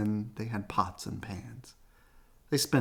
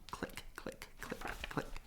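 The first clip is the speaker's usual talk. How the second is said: whispered clicks; 16 dB quieter than usual. Acted. Camera clicks.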